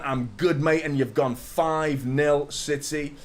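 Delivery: ordinary delivery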